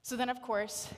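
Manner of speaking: ordinary talk, nothing marked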